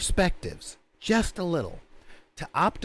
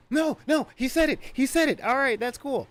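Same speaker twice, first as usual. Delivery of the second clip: squeaky, higher mock insistence; 10 semitones higher than usual. Wizard insisting he got the spell right, high-pitched.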